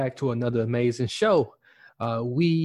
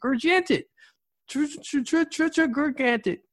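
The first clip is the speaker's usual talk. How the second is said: very high, comic stuttering on a big word; playful high voice; 12 semitones higher than usual. Pretend. Playing with the word gigantic.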